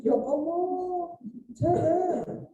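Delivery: high, slow, drawn-out mock complaint; mock whining husband. Preacher mimicking a husband who won't dress neatly, whiny and high. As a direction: ham up a husband groaning at dressing up.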